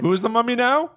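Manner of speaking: high, teasing trailer question; dramatic tease. Acted. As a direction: ask it like a hammy trailer. Dramatic rhetorical movie question.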